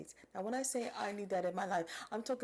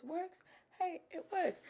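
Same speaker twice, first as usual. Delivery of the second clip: shrugging approval; 11 semitones higher than usual. Real, not acted. Shrugging acceptance.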